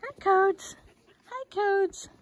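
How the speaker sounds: high, loud sing-song puppy voice; pet talk. Real, not acted. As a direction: coo up high at the dog. Greeting the dog Cody.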